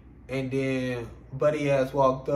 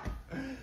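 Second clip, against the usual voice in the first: burst of laughter; 8 semitones higher, 14 dB quieter than usual. Real, not acted. Laughs after quote.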